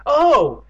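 high, loud dismayed "oh"; moan. A narrator's reading. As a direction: wail it out loudly. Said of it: "Evan moaned".

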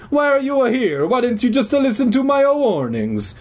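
high, indignant stage-Italian; angry Italian accent. A narrator's reading. Janitor defending his home from kids, angry Italian accent. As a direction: protest in a hammed-up Italian accent. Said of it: "the man said angrily".

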